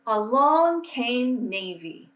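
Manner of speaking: put-on reading a picture book aloud to children, unhurried; storytelling voice. A narrator's reading. Teacher reading to her class, gentle storytelling voice. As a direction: paint each colourful list for young listeners.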